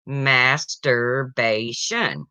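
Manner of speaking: slow, proud, drawn-out word; proudly. Acted. Johnny proudly answering teacher, slow and savouring. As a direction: savour every syllable proudly. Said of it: "Johnny proudly says".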